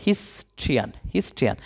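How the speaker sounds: soft, low model of হিস্ট্রিয়ান; pronunciation demo. Shown as a demonstration. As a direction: sound out হিস্ট্রিয়ান as "his-tri-an", twice. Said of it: "যখন বলতেছো তখন কি বলতেছো".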